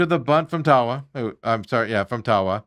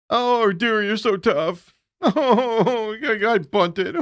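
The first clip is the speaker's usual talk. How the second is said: higher, sing-song oh dear with a laugh; mocking baby talk, slowing at the end; sarcastic mock sympathy; 6 semitones higher, much slower than usual. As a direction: tease them like pouting children. Sarcastic mock of offended players.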